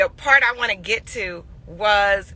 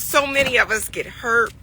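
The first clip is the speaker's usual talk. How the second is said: usual speaking voice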